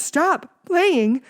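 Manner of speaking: high, loud frightened call; scared boy calling. A narrator's reading. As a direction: yell down to your hands. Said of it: "I called down to my hands".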